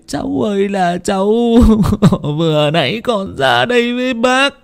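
keen over the dead nephew; aunt mourning her nephew, wailing; wailing lament. Acted. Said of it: "cũng thảng thốt".

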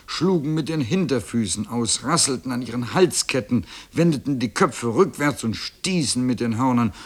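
ordinary delivery

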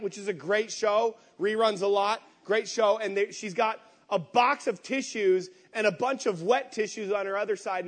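usual speaking voice

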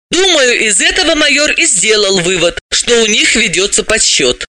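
high-pitched, insistent explainer; high character voice, objecting; animated female character, arguing. Acted.

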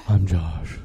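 low breathy introduction. Pretend. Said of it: "I gotta get real breathy".

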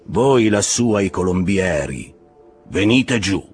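calling aloft. A narrator's reading. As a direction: call up to men high in the rigging. Captain calls up to the lookouts. (richiamo forte verso l'alto)

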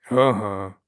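short, loud grunt of agreement; grunted assent. A narrator's reading. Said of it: "буркнув мовчун". (коротко буркнути на згоду)

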